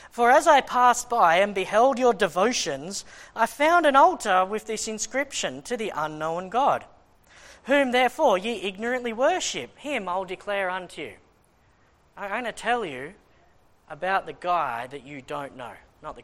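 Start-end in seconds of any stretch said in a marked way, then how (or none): 0.1-11.2 measured, declaiming King James reading; scripture reading. Acted. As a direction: read it out like holy writ. Reads scripture aloud.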